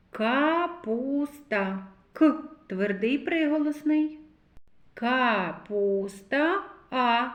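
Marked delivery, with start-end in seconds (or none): 0.1-1.9 капуста stretched very slowly, sound by sound; drawn-out word. Shown as a demonstration. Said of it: "Звуковий аналіз слова капуста". 4.9-6.6 капуста stretched very slowly, sound by sound; drawn-out word. Shown as a demonstration. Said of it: "teacher stretches word per sound".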